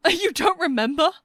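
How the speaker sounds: high, incredulous laugh through tears; chuckle mixed with sob. Acted. Toby to his forgetful father, half chuckling, half sobbing. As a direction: laugh in disbelief, near a sob. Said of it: "what sounded like a chuckle mixed with a sob".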